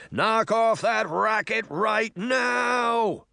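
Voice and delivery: high, slow, drawn-out bellow; put-on outraged voice, every word stretched; angry shout. A narrator's reading. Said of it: "the voice shouted angrily".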